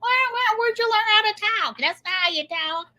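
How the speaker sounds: high, fast mocking trucker. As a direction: tease her accent in a high voice. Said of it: "they talk about my ... New England accent".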